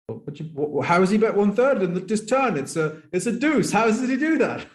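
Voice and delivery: high-pitched, incredulous stammer; mock a flustered player in disbelief; freaking out. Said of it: "freaks out and goes".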